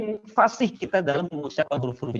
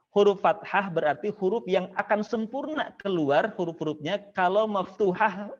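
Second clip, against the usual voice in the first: ordinary talk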